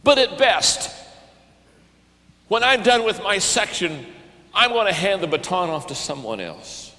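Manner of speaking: usual speaking voice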